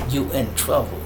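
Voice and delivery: usual speaking voice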